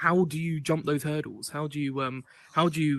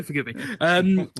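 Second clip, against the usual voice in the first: usual speaking voice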